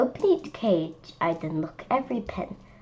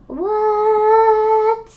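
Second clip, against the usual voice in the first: loud, much higher "What?"; astonished; 13 semitones higher, 9 dB louder than usual. Real, not acted. Child reacting to the lion picture, loud astonished shout. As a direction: shout out your disbelief.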